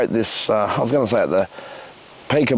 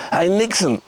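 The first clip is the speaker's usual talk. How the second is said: gurgling; 8 semitones higher than usual. Acted. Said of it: "they gurgle".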